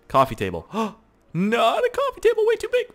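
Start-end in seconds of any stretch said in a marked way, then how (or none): 0.7-2.9 hammed-up high shocked squeal; mock gasp. Rejecting the coffee table in mock horror, squealed high.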